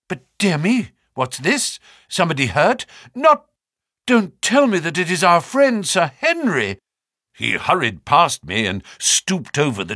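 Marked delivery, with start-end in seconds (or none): 0.1-6.8 higher, louder exclamations of dismay; acted alarm. A narrator's reading. Naturalist finding a body on the moor, alarmed. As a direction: build from startled questions to alarmed plea. Stapleton fears body is Sir Henry.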